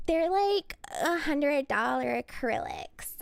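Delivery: put-on slow Valley girl drawl; Valley girl accent. Streamer showing off her nails, slow Valley girl accent. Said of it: "I'll just have to talk like this".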